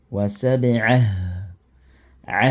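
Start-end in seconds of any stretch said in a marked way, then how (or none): 0.1-1.0 wa sab'a stopped on the final a, no t; pause form. Shown as a demonstration. Teacher models the stop form for students, cut clean. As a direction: end "sab'a" cleanly without the t sound. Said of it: "voilà ce que moi, je dois prononcer".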